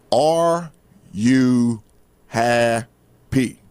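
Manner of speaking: slow, word by word with long pauses; really clear. Real, not acted. Host putting the main question to listeners, really clear. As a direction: spell it out slowly and deliberately. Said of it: "I'm gonna say it really clear".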